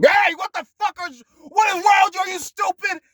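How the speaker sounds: shrieking high insults; mock furious yell. Imitating an aggressive teacher yelling, high loud shout.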